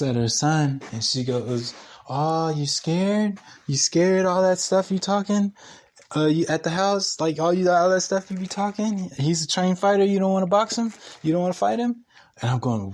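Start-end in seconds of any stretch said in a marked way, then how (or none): 2.1-12.0 high-pitched mock taunting mom. Landlord daring tenant to fight her son, taunting. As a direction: goad him like a mother daring a fighter, pitched way up.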